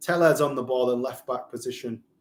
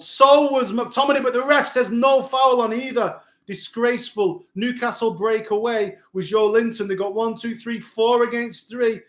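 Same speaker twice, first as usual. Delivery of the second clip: much higher and louder, indignant commentary; outraged excitement; 7 semitones higher, 4 dB louder than usual. Real, not acted. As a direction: rail at the referee's call, then ride the break. Outraged at ref, then counterattack.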